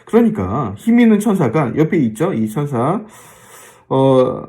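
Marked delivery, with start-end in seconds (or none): none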